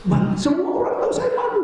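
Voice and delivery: wife shouting her shame at her husband, very high and loud; upset wife. Retold or imitated.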